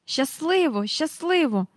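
high-pitched sing-out farewell; calling out. A narrator's reading. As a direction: call goodbye in a piping voice. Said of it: "гукнула вона".

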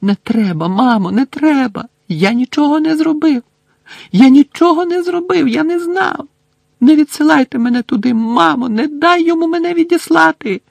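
very high, loud hysterical pleas; screaming in terror. A narrator's reading. Son screaming to mother not to send him away. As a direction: scream and beg. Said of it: "Хлопець почав кричати".

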